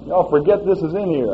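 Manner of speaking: loud, impassioned preaching; preaching at full force. Acted. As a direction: drive it home to the congregation.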